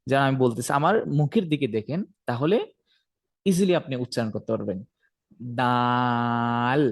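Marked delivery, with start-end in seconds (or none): 5.6-6.8 "দাল" stretched very long on one note; open-mouthed long "দাল". Shown as a demonstration. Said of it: "মুখটাকে ফাঁকা হবে".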